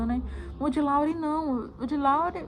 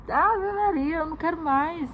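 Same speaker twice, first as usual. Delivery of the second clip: higher, louder exclaimed refusal; emphatic refusal; 5 semitones higher, 5 dB louder than usual. Retold or imitated. Mother answering if she wants more children, emphatic refusal. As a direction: exclaim it like swearing off something for good.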